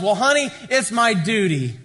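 high, loud comic reply to the wife; mock dutiful husband. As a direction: play the husband's tactless answer for laughs. Acted husband answering his delighted wife.

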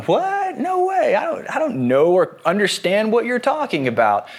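squeaky high start, easing down toward normal pitch; mock disbelief. Peter playing innocent to the servant girl, mock disbelief. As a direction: play hammed-up innocent surprise. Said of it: "isn't looking at this girl and going".

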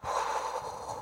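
long soft sigh of release; relieved sigh. Retold or imitated. Child melting into her mother's touch with a sigh. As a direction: let the whole body sigh out.

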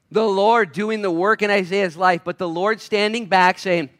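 high, slow, emphatic preacher's delivery; impassioned preaching. Real, not acted. Preacher on the Lord working in Isaiah's life, impassioned. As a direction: spell it out forcefully for the congregation.

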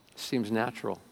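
quiet echo leaning on seems. Shown as a demonstration. Preacher quietly repeating his point to the congregation, hushed. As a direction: say "It seems natural" again, hushed, pressing on seems.

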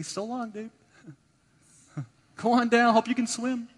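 high, loud, joking send-off; mock dismissal. Imagined Jesus to sinking Peter, mock cheery dismissal. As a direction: call out a flippant goodbye with a laugh.

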